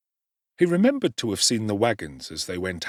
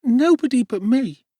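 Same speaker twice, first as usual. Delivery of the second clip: put-on much higher, louder little child's voice; small child Dick; 9 dB louder than usual. A narrator's reading. Sick little Dick answering Oliver, high child voice. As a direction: answer as a frail small boy.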